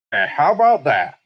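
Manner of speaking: a lightly hammed-up showman's punchline; hacky catchphrase. Troupe leader mocked for his hacky catchphrase, lightly hammed-up. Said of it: "That was his catchphrase".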